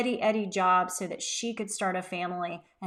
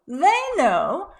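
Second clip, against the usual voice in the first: mock confident; 8 semitones higher, 9 dB louder than usual. Shown as a demonstration. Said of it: "My team understands expectations".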